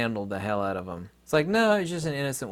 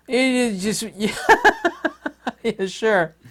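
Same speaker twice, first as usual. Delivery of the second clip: sarcastic; 11 semitones higher, 7 dB louder, slower than usual. Real, not acted. Sarcastic reply to mock Aussie.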